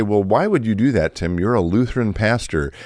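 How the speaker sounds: ordinary talk, nothing marked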